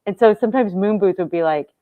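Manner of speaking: usual speaking voice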